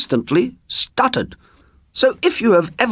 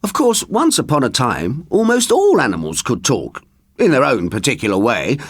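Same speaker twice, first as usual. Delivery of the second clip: usual speaking voice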